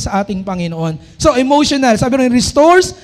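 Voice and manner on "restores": slow, loud, solemn verse reading; scripture reading. Acted. Preacher proclaiming a verse to the congregation, slow and loud. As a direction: proclaim each word of the verse slowly.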